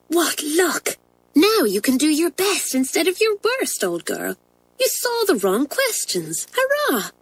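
high, hushed glee, not fully whispered; whisper-cheer a secret to a classmate in a high girlish voice; excited stage whisper. A narrator's reading. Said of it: "leant across and whispered to Isabel".

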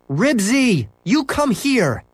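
high, slow angry call; calling the dog. A narrator's reading. Boy calling his runaway dog, high and stern.

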